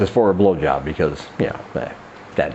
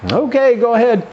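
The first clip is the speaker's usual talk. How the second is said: put-on agreeable girlfriend; 12 semitones higher, 3 dB louder than usual. Acted woman agreeing to partner's request.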